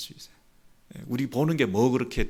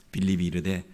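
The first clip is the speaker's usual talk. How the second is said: ordinary talk, nothing marked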